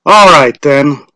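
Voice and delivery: a hint of a village official calling out; lightly voiced lottery official; Mr. Summers' voice. A narrator's reading.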